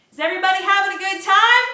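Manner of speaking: rally the whole room with a loud fast shout. Acted. Waitress rallying the diner crowd, loud fast high shout. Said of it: "I shout".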